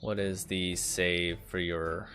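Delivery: ordinary talk, nothing marked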